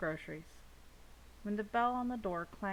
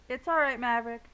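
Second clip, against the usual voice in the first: loud, higher call across the street; hollering; 5 semitones higher, 10 dB louder than usual. A narrator's reading. Onlooker hollering comfort to Maverick: loud call. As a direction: call out to him from far. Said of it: "One person hollers out".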